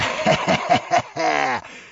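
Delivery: loud, gloating laugh; disgusting guffaw. A narrator's reading. The prince laughing hideously at Natasha. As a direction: laugh nastily in her face. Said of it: "отвратительный хохот его".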